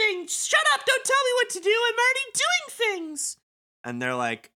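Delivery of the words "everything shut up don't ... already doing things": very high, squeaky character voice; Coco. Acted. Coco brushes off the others' orders, exasperated squeaky voice. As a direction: snap back in a sky-high, hammed-up squeal. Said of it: "and she's like".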